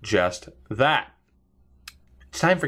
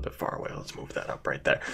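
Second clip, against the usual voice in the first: quiet aside; 13 semitones lower, 12 dB quieter, faster than usual. Real, not acted. Aside while moving mic.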